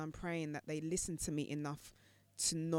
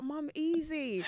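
calming; 9 semitones higher, slower than usual. Retold or imitated. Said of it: "I was like".

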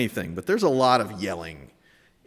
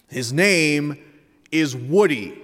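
measured, emphatic correction, a pause mid-sentence; telling his brother; 3 semitones higher, 4 dB louder, slower than usual. Retold or imitated. Older son correcting his little brother, insistent but contained. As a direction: set your brother straight, firmly but not yelling. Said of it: "Ethan tells his brother".

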